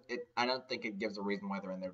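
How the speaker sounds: usual speaking voice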